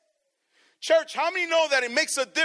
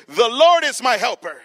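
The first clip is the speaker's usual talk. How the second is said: louder, bold repeat; 8 dB louder than usual. Shown as a demonstration. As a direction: declare it loudly and boldly. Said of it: "tell your neighbor".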